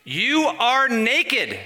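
high, loud, very drawn-out words; incredulous exclamation. Real, not acted. Preacher amazed the church cannot see itself, high drawn-out exclamation.

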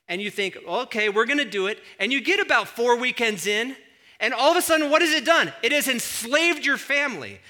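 high, loud, mounting pulpit delivery; full-force preaching. Real, not acted. Preacher on youth sports taking over families, loud impassioned preaching. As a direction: belt out the example as a rousing sermon.

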